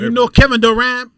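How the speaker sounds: high, loud mock Filipino accent. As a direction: imitate an eager Filipino asker with a heavy accent. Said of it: "do they ask you".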